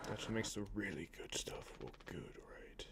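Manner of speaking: unvoiced whisper. Real, not acted. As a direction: breathe it out with no voice. Whispering commentary.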